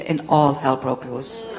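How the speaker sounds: ordinary delivery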